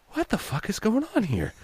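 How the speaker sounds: high, quiet, baffled squeak; hushed bewilderment. Retold or imitated. Voice actor alone in the booth watching clothes appear, hushed bewilderment. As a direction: think it aloud, small and high.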